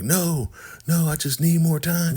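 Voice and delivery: high-pitched acted begging; hammed-up desperate protest; pleading. Peter begs to avoid punishment.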